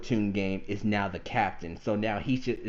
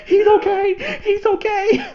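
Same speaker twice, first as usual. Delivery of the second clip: very high, loud, breathless relief; relieved exclaim; 12 dB louder, slower than usual. Retold or imitated. Fan reacting to Cuttlefish surviving, squealing high with relief. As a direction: gush with joy that he survived. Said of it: "So I was like".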